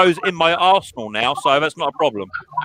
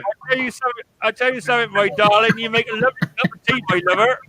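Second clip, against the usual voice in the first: lightly voiced West Country charmer, higher; West Country accent; 5 semitones higher than usual. Mocking a friend charming his mother-in-law in West Country accent. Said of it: "He gives it all that accent".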